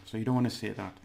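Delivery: ordinary talk, nothing marked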